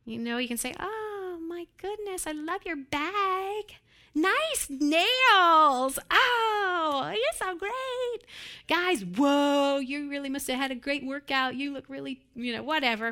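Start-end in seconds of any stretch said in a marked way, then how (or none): 0.8-12.2 much higher, breathy-sweet mock gushing; gushing flattery. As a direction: lay the flattery on thick.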